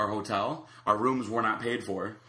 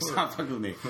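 higher, mock-exasperated voice; fed up, pitched up; retold annoyed protest; 4 semitones higher than usual. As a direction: imitate a friend who has had enough. Retold outburst at a prankster.

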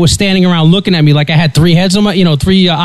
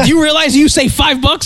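much higher, delighted cashier voice; excited cashier; 10 semitones higher than usual. Retold or imitated. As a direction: imitate a cashier thrilled about a sale. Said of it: "she was so excited".

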